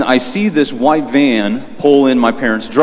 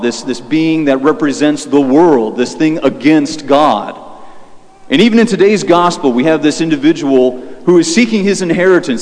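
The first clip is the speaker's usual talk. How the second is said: normal talking voice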